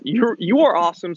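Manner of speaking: ordinary delivery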